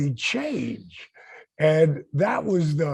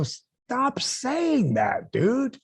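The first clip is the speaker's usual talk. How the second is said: high, slow, drawn-out plea to stop; firm pleading; 8 semitones higher, much slower than usual. Retold or imitated. Friend begging Jake to stop insulting himself, slow and high. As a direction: stretch it out, begging him to quit.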